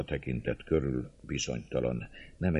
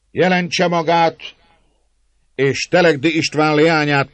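high, loud public announcement; leader's proclamation; 11 semitones higher, 12 dB louder than usual. A narrator's reading. Leader offering a bride to his men, loud proclamation. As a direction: call it out to the whole crowd. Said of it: "A vezér e szavait".

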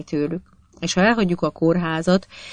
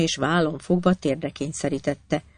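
plain reading voice

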